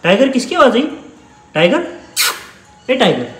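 high, loud call to the cat. Real, not acted. Calling to the cat. (बिल्ली को ऊँची आवाज़ में पुकारना)

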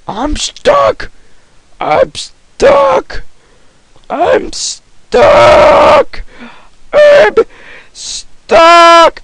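very high, cracking shout; hammed-up panic. Gamer stuck in the game, squealing mock panic.

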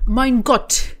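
put-on poor go at Žižek's Slovenian accent; half-hearted Slovenian accent; attempted Žižek accent. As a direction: have a tentative stab at a Žižek accent. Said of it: "I can't do the accent".

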